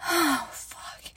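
breathy whispered curse; aroused whisper. Acted. To her partner, in a turned-on whisper.

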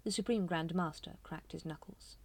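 ordinary delivery, nothing marked

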